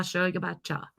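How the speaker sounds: normal talking voice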